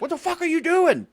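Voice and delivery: very high, incredulous outburst; imitated shocked shout, pitch shooting up; mock alarmed yell. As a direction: call out in disbelief after a runaway. Voicing cops alarmed by Nick running.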